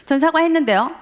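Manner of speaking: a son escalating the quarrel with his father, heated defiance; heated insistence. Retold or imitated. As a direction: dig in and push back harder. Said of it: "점점 서로 격해".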